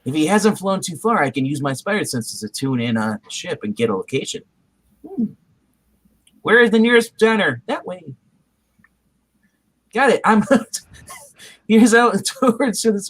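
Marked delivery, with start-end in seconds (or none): none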